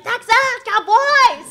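imitating the Mongolian horsemen hailing the Texan; laughing imitated horsemen. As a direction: mimic the horsemen's delight. Horsemen quoted.

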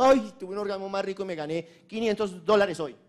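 ordinary talk

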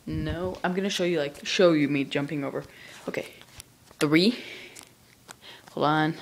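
ordinary talk, nothing marked

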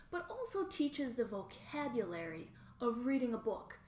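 usual speaking voice

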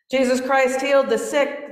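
ordinary talk, nothing marked

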